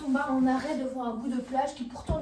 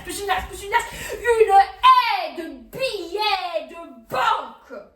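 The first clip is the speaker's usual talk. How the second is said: loud, relentless pile-up climbing to a cry; frantic escalation; 4 semitones higher, 9 dB louder than usual. A narrator's reading. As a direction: build the repetitions louder and higher, then stretch the climax. Frantic piling up of banknotes. (énumération frénétique qui monte jusqu'au cri)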